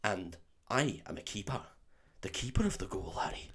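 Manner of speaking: soft put-on Scottish accent; Oliver Wood. Quidditch keeper teaching Harry, hushed Scottish accent. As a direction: speak low-key, solemn Scots. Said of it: "for me to do a Scottish accent".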